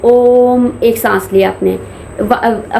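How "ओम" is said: drawn-out, held ओम; one breath per word. Shown as a demonstration. Teacher showing breath-paced chanting, a long slow ओम. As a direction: stretch ओम out over a single breath. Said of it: "जैसे एक सांस में".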